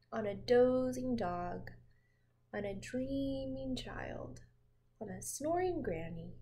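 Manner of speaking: ordinary delivery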